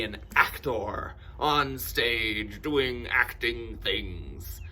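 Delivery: loud, slow, grandly stretched words; theatrical actor. Pretend. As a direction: declaim it like a hammy stage actor. Mock-grand actor voice while describing acting.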